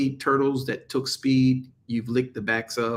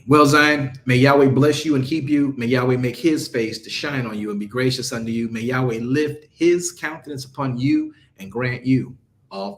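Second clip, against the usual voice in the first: louder priestly blessing that fades out; spoken blessing; 5 dB louder than usual. Acted. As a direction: bless the listener with solemn warmth. Gives a blessing.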